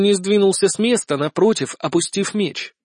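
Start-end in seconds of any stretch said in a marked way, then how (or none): none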